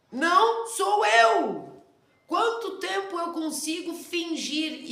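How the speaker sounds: loud, high, slow cry of rejection; emphatic outburst. Real, not acted. Teacher rejecting the fake persona, shouting loudly.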